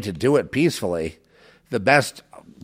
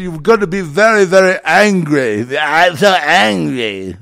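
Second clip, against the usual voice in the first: slow, loud, high mock-villain voice; drawn-out loud villain impression; Klaus Schwab parody; 8 semitones higher, 9 dB louder than usual. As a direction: ham up a sinister billionaire warning. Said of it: "the parody".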